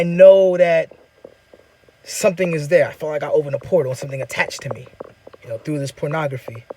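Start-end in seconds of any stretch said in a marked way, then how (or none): none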